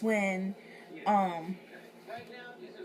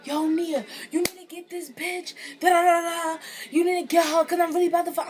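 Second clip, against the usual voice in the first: loud, higher, hyped-up friend yelling for help; urgent loud call; 9 semitones higher, 7 dB louder than usual. Retold or imitated. Friend yelling at Nia to get the girl, loud and urgent. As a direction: shout it like you're begging someone to break it up. Friend urging her to intervene.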